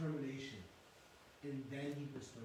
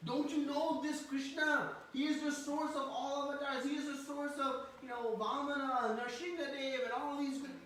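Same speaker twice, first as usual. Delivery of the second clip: put-on boasting older brother; 12 semitones higher, 5 dB louder than usual. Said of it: "Balaram started to get more courage".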